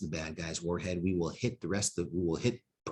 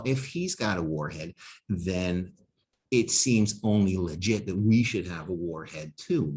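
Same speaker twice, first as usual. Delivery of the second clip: ordinary talk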